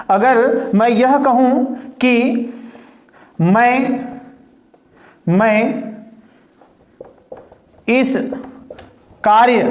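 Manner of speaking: slow, long pauses between words; slow dictation with long gaps. Shown as a demonstration. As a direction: give the class time to write each word. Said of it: "dictates word by word with pauses".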